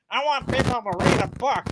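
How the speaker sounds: a hint of a stuffed cat talking. Stuffed cat Fluffy answering its owner, lightly voiced.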